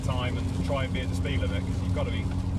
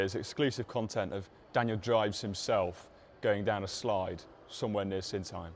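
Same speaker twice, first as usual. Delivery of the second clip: normal talking voice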